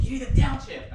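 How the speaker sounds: loud, high retold yell; imitated yelling. Dad teaching his child stick shift, imitated yelling. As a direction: yell it like an impatient instructor. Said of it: "He always yelled".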